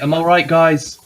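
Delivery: yelling across. Acted. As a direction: shout over to the group. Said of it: "I scream across and say".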